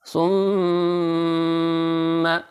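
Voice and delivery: सुम्मा with the मीम held and nasalized; मीम मुशद्दद पर ग़ुन्ना. Shown as a demonstration. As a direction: hum through the nose on सुम्मा's मीम. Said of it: "orange colour पे मीम मुशद्दद, थोड़ी देर नाक से आवाज़". (सुम्मा में नाक से आवाज़)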